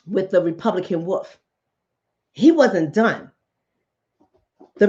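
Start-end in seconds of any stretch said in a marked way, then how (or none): none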